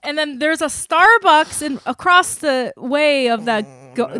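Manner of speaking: ordinary talk, nothing marked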